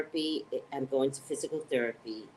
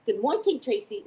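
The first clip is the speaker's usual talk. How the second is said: high, loud Caribbean-style greeting; Caribbean accent; 8 semitones higher, 3 dB louder than usual. Shown as a demonstration. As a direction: try a lilting Caribbean accent, pitched up. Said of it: "It's Caribbean".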